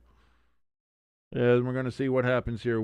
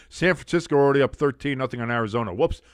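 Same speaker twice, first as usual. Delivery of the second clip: ordinary delivery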